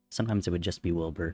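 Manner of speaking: ordinary delivery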